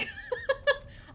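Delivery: squeaky burst of laughter; high laugh. Real, not acted. Streamer amused by talk of their accent, high laugh. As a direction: crack up in high giggles.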